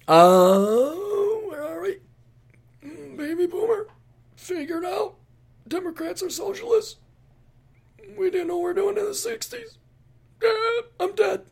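very high, feeble mock-crying voice; hammed-up dying whimper in falsetto; dying baby boomer. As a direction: squeak it out pitifully like someone expiring.

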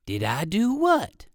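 a bird surprised by the question. Acted. The bird answers.

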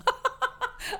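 high delighted laugh bursting out; a happy, high burst of laughter; bright laugh. Real, not acted. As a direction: laugh at the memory with real delight. Delighted laugh at her own story.

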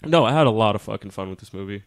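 normal talking voice

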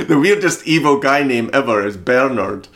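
giggling through the words; laughing. Real, not acted. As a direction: laugh while saying it. Laughing at the name.

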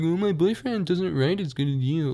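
high, breezy mock of her excuse; mimicking the classmate. Quoting the classmate's flattering excuse, high mock voice. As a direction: toss off her excuse in a lilting put-on voice.

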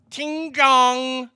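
"Tiangong" loud and drawn out; exaggerated pronunciation. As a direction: belt out "Tiangong" with stretched second syllable. Exaggerates foreign station name.

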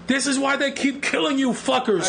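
high mock yell at the sky; a high, half-joking shout at the helicopter; retold yell at helicopter. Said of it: "I yelled at the helicopter".

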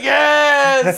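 long, drawn-out triumphant yell; laughing, exaggerated shout of joy; mock ecstatic cry. Acts out the character's ecstatic cry.

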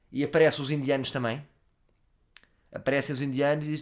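ordinary talk, nothing marked

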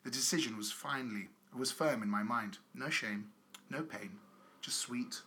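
low, measured reading aloud; letter reading. Acted. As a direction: read a solemn confessional letter, deadpan. Reads out a letter.

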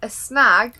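loud, dramatic announcement. Real, not acted. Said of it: "It's very dramatic".

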